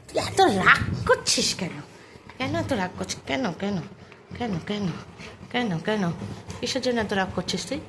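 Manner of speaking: low, soft murmured chiding; soft coaxing scold. Real, not acted. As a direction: chide the sulking dog softly and low. Coaxing and chiding an angry dog. (নিচু গলায় আদুরে বকুনি)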